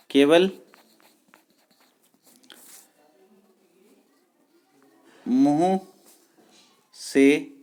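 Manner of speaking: each word spaced out for notes; slow dictation. Shown as a demonstration. Teacher dictating to the class slowly, word by word. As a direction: dictate slowly so students can write.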